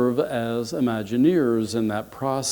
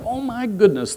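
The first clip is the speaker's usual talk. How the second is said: high-pitched thrilled exclamation; explosive excitement; 10 semitones higher than usual. Acted. Potato family hearing a third engagement, exploding with excitement. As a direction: burst out with squealing delight. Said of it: "the place just explodes with excitement".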